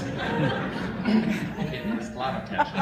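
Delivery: words squeezed out through laughter; laughing too hard to say it straight. Real, not acted. A son at his father's eulogy, laughing through the words.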